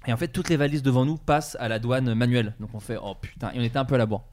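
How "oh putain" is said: low, hushed curse; quiet sinking realisation; retold dismay. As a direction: let it drop, a deflated muttered aside.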